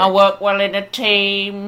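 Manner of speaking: slow, put-on eager applicant; mock CV applicant. Host parodying a CV line, slow and mock earnest. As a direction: drag it out like a hollow boast. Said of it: "Stick that on your C V".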